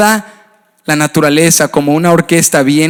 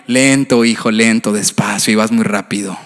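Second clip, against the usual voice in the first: gentle voice of God; 9 semitones lower than usual. Retold or imitated. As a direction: soothe him like a patient father. God's voice gently slowing him.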